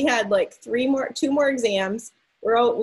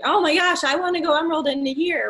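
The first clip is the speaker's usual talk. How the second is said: higher, faster, a touch of mock excitement; mock eager; 6 semitones higher, faster than usual. Imitating other sellers who rush, in mock eager voice. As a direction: play the impatient newbie, lightly hammed. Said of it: "some people might be thinking".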